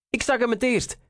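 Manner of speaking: hoog, opgewonden geroepen; uitroep. A narrator's reading. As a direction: call it out high, claiming first sight. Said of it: "riep Reinier". (hoog uitgeroepen)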